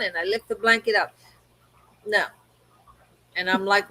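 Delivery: ordinary delivery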